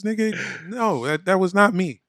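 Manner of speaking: usual speaking voice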